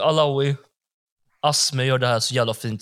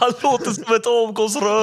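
words spoken through laughter, much higher; laughing; 10 semitones higher, 4 dB louder than usual. Real, not acted. Cracking up at the car comparison, laughing through it.

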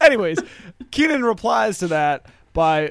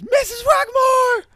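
high, drawn-out character shout; yell; 5 dB louder, slower than usual. Acted. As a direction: holler it loud and very high, stretched out. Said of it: "and he yells".

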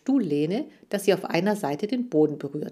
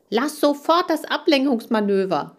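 loud, higher shout; 7 semitones higher, 5 dB louder than usual. A narrator's reading. Neo shouting at Lee to stop the distraction. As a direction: yell at him to stop. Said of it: "schrie ich".

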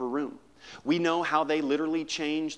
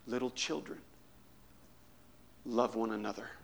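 put-on weak old voice; 3 semitones lower, 9 dB quieter than usual. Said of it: "he had a very weak voice".

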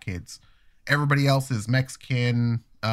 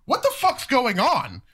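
slow, high, angry challenge; confronting; 10 semitones higher than usual. Retold or imitated. Father confronting the dentist's staff, slow and angry. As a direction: storm in demanding answers. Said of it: "I'm like".

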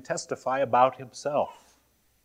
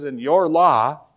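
slow, louder, pointed sarcastic quote; 3 semitones higher, 6 dB louder, slower than usual. Acted. Quoting Jesus to his critics on the law, mildly sarcastic. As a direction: lean sarcastically on "your". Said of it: "sarcastically saying".

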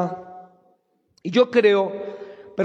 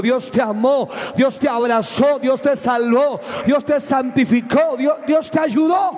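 each line climbing higher until a shouted finish; building preaching; 4 semitones higher than usual. Real, not acted. Preacher lifting the congregation, rising to a shout. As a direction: build the praise step by step to a cry.

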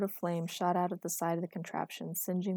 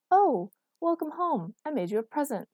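lightly voiced cheerful Erica; Erica's bright voice; 7 semitones higher, 7 dB louder than usual. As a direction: greet him like a delighted girlfriend. Erica greets Marcus brightly.

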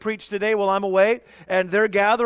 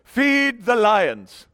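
mock grand declaration; much slower than usual. Pastor imagining a more flattering verse, mock grand. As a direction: proclaim it grandly and slowly, playfully. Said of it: "would have been great if".

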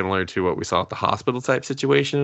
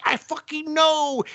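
very high, drawn-out comic comeback; mock exasperated retort; slower than usual. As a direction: squeal back slowly, hamming up the frustration. Acted retort at the stalled car.

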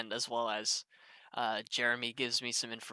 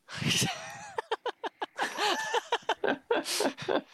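high-pitched laugh; high burst of laughter. Real, not acted. Laughs at the joke.

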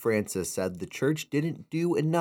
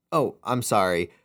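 ordinary delivery

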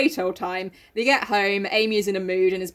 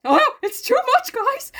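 high anguished wail; 4 dB louder than usual. Retold or imitated. Jo breaking down.

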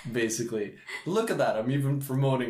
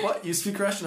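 high, louder imitation of disbelief; mock shock; 11 semitones higher, 3 dB louder than usual. Russian girls learning he understood them, high mock disbelief. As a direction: play the startled girls, pitched up high.

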